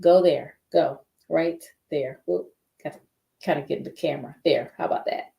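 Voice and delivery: usual speaking voice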